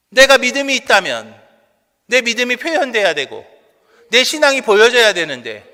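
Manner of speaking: loud, high preaching with punched phrases; forceful preaching. Real, not acted. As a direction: drive each point home to the congregation. (힘주어 외치는 설교 목소리)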